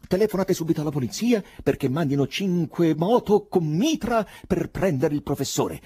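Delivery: high, loud command; urgent orders. A narrator's reading. Poet demanding police for the professor, urgent. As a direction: order the crowd into action.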